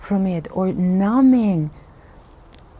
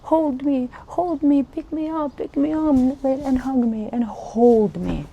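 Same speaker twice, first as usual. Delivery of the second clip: put-on high-pitched toddler begging a parent; pleading baby; 6 semitones higher than usual. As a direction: beg to be picked up like a small child. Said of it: "run after parents and be like".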